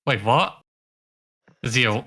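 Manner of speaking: ordinary talk, nothing marked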